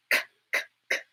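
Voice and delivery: k, k, k said as a breathy unvoiced k; whispered k sound. Shown as a demonstration. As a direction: breathe out a sharp k, k, k with no voice. Letter sound demo whispered.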